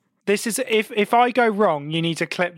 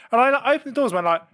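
ordinary delivery

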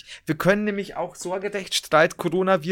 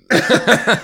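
loud cough from choking; 7 semitones higher, 10 dB louder than usual. Real, not acted. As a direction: cough through the choke. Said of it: "ich hab mich verschluckt".

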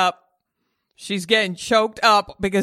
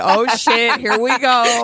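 louder, playful cave woman exclaiming; mock surprised; 3 semitones higher, 5 dB louder, slower than usual. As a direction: blurt it out as the grinding starts. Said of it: "she said".

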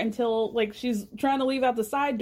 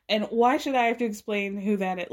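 normal talking voice

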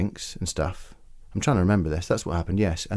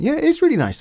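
put-on much higher, girlish piping. Mimics the other girls piping up, much higher. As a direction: pipe up eagerly like the girls. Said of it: "piped up and went".

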